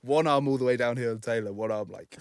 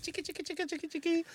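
high, squeaky mock bell jingle; high squeaky chicka sound; 5 dB quieter, faster than usual. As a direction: chirp it like a silly jingle. Bell sound after subscribe plug.

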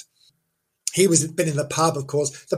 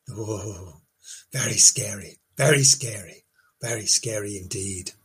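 low, hushed mock dread; deadpan spooky storyteller; 9 semitones lower, 6 dB quieter than usual. Mock-spooky close to a ghost legend.

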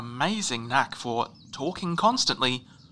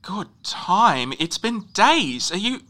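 louder and higher, slow, disbelieving; incredulous; 8 semitones higher, 7 dB louder, slower than usual. Real, not acted. Guide disbelieving the ranger's claim of good time, incredulous. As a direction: throw the words back in outrage. Indignant at days-long journey.

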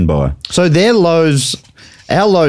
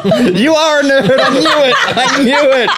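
high, laughing jab at a friend; laughing tease; 12 semitones higher, slower than usual. Real, not acted. Teasing a friend about his nerdy claws fact, laughing. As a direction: giggle through the accusation.

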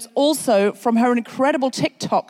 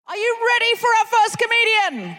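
hype shout; 10 semitones higher than usual. Real, not acted. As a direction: pump up the audience. Host hyping the crowd.

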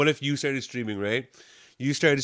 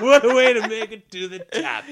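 high, loud, cracking up through the words; real helpless laughter; laughing hard; 10 semitones higher, 8 dB louder than usual. Real, not acted.

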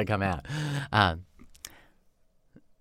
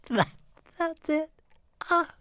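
high breathy fake moan, slow; mock moaning; 14 semitones higher, 7 dB quieter, slower than usual. [name] hamming up pleasure, high breathy moans. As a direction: ham up the moaning.

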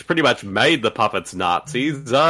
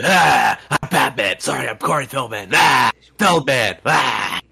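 raspy over-the-top growl. Retold or imitated. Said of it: "voice gets really raspy".

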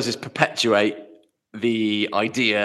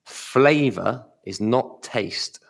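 slow and deliberate; much slower than usual. Real, not acted. States the key fact slowly.

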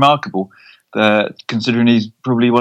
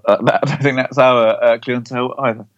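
normal talking voice

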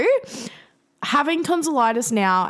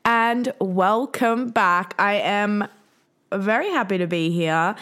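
podcast intro; 3 semitones higher, slower than usual. Acted.

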